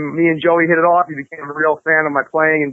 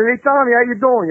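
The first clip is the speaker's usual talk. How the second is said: higher-pitched imitated Italian-American accent; put-on Italian accent, pitched up; Joey Ballin's Italian accent; 8 semitones higher than usual. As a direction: imitate a producer's heavy Italian accent on an eager phone greeting.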